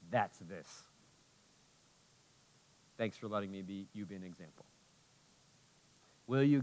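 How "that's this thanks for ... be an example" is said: choked quiet. Real, not acted. Preacher moved after testimony.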